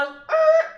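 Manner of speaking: high, loud wrong-answer buzzer; loud vocal buzz for a wrong answer; buzzer imitation. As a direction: blurt out a mock game-show buzzer.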